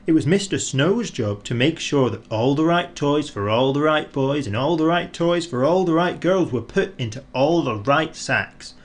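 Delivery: ordinary delivery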